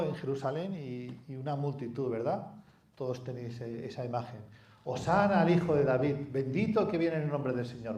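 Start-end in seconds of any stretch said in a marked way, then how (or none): 4.8-8.0 crowd acclaim. Retold or imitated. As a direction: cheer like the crowd in the street. Crowd acclaiming Jesus voiced. (aclamación festiva de la multitud)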